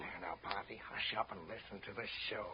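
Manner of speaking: quiet her down so the audience can't hear; hushing. Acted. Husband hushing wife during show.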